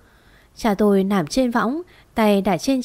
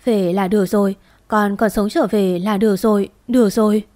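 plain reading voice

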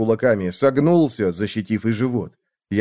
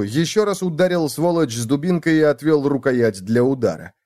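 ordinary delivery

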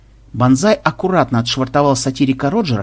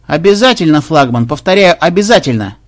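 hailing assent; 2 semitones higher, 4 dB louder than usual. Acted. Pirate assuring the flagship by megaphone, raised emphatic call. Махмуд отвечает флагману в рупор.

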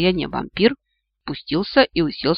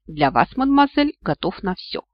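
usual speaking voice

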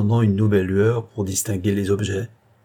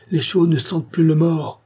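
high, urgent cry; desperate; 7 semitones higher, 3 dB louder than usual. A narrator's reading. Coachman on the runaway horses to his master, high desperate cry. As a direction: cry out that control is lost. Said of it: "d'une voix désespérée".

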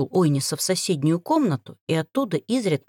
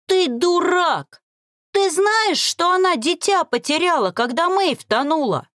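sobbing, high-pitched angry cry; 10 semitones higher, 3 dB louder than usual. Acted. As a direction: yell it through tears, higher. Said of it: "Рыдая, прокричал Лиам".

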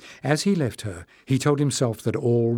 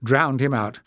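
ordinary delivery, nothing marked

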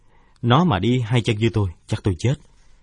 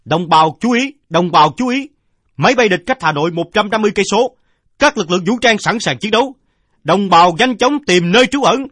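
much higher, much louder public address call; loudspeaker announcement; 12 semitones higher, 9 dB louder than usual. A narrator's reading. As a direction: blare it like a city air-raid loudspeaker. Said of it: "Tiếng loa phóng thanh nghe vang vang".

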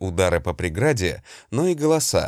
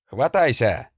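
shout; 2 semitones higher than usual. Acted. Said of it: "крикнув".

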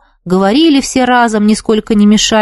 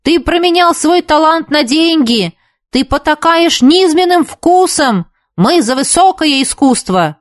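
shouting while running; 7 semitones higher than usual. A narrator's reading. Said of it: "закричал на бегу Игорь".

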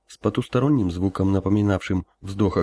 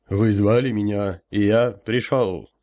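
booming elder voice; slower than usual. Acted. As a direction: boom it like a summoned spirit. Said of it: "прогудел я".

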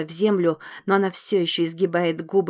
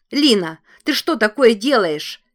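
alarmed shout; 5 semitones higher, 8 dB louder than usual. A narrator's reading. Coral alarmed at attack.

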